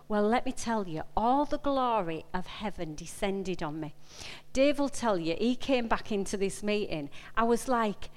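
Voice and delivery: ordinary delivery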